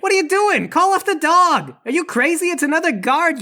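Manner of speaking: high-pitched, urgent outraged demand; outraged yelling. Acted. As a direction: order the dog called off in alarm. Demanding dog be called off.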